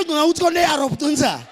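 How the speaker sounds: ordinary talk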